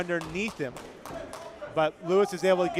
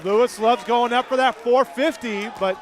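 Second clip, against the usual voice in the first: rising, shouted play-by-play; wrestling commentator; 7 semitones higher, 6 dB louder than usual. Real, not acted. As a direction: call the action louder and louder as it heats up.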